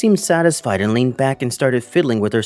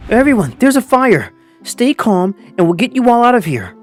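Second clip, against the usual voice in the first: raised, urgent warning to a class; panicked; 6 semitones higher, 4 dB louder than usual. A narrator's reading. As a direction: call out urgently, pitch pushed up. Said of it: "I was in a panic".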